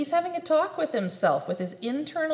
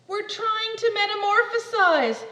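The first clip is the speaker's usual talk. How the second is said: annoyed; 9 semitones higher, 8 dB louder than usual. Acted. Annoyed caterpillar.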